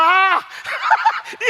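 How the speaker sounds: high shouted laughter; loud laugh. Real, not acted. Preacher laughing loudly at his own punchline. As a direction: burst out laughing.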